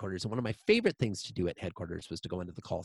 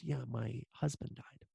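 quiet grief; 2 semitones higher, 8 dB quieter than usual. Retold or imitated. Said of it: "breaks down".

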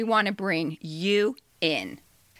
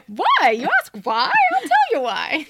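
mock drama; 10 semitones higher, 4 dB louder than usual. Mock-dramatic answer to co-host.

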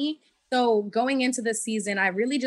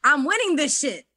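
high, loud triumphant inner voice; excited; 8 semitones higher, 8 dB louder than usual. Retold or imitated. Player retelling her thoughts on returning, excited. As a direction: declare it louder and higher, thrilled. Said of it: "I was just so excited".

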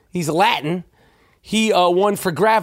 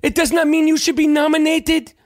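a lighter hint of the Concussion African accent, pitched up; Will Smith's Concussion accent; 8 semitones higher than usual. Podcaster still lightly mocking Will Smith's Concussion accent. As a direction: keep the stiff Concussion accent going, a bit looser. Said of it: "I am doing the same accent that he did in Concussion".

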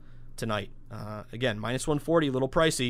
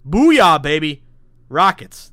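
excited cheer; 2 semitones higher, 13 dB louder, much slower than usual. Real, not acted. Host cheering the Rockets prop bet that cashed, loud excited whoop. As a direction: shout it out like a win at the window.

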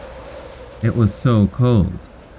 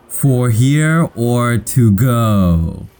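drawn-out, higher-pitched cashier's question; slow repeated question; 6 semitones higher, much slower than usual. Acted. Cashier repeats the order question to confused newcomer, very slowly. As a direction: stretch every word out slowly for someone who didn't understand. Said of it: "he said again".

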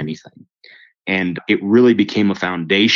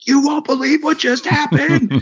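very high-pitched excited squeal, re-enacted; freaking out. Calling his buddies with wild news, high freaked-out excitement. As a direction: act out freaking out, voice shooting way up. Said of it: "like, freaking out".

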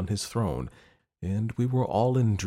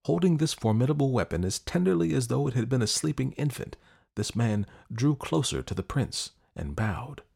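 usual speaking voice

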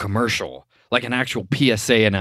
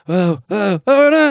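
high yelps building louder; mock panic; 9 semitones higher, 5 dB louder than usual. Driver about to crash, rising panic. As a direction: imitate a driver losing control. Driver losing control in retold ad.

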